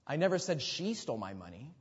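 "she" singled out with emphasis; emphasize "she" above all other words; stress on SHE. Shown as a demonstration. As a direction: put the weight on "she". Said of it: "emphasize a different word".

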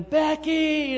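high, loud full-voice call; as loud as possible. Retold or imitated. Mother calling her daughter as loud as she can, high shout. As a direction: yell the name with everything you have. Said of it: "as loud as she possibly could".

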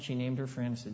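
normal talking voice

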